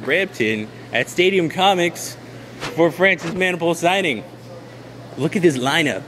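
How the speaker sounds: mock field reporter. Hosts' clip, reporting from the signing in a mock field reporter voice. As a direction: play the TV reporter live from the lineup.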